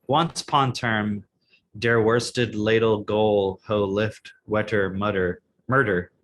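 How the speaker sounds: slow, halting read of nonsense words; word-by-word literal reading. Shown as a demonstration. Cohost reads the garbled fairy tale aloud, slow and literal. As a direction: read each odd word just as written. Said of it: "See, I'm just reading it as the words".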